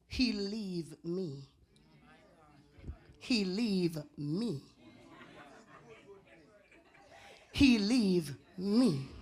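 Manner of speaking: mock heartbroken. Preacher mimics heartbroken woman.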